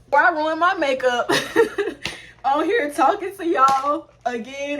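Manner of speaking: high, loud, laughing speech; words breaking into a high giggle; laughing through emotion. Real, not acted. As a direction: laugh it out while fighting back tears. Laughs while fighting back tears.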